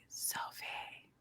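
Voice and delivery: soft whisper, slow; whispered tease. Retold or imitated. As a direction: coax her awake with a whisper. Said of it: "I'll be like, whisper".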